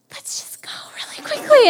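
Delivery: hurried, high whisper; urgent whisper. Retold or imitated. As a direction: whisper to hustle the parents out. Kid urging parents away.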